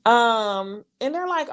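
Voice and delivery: ordinary talk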